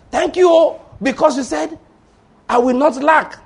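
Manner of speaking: high, loud praying cry; joyful praise. Acted. Believer thanking God in prayer, high and loud. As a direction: lift it up in thanksgiving.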